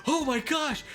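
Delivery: high, shocked cartoon voice; South Park quote. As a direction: squeal it like a cartoon kid. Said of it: "you hear a voice go".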